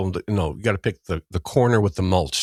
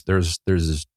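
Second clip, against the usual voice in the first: usual speaking voice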